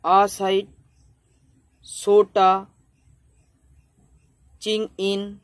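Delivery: আ side, সোটা, চিং ইন broken apart with long gaps; split into syllables. Shown as a demonstration. Teacher breaking a verse line into syllables for students. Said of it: "এরকম করে আমাদেরকে ভাঙতে হবে".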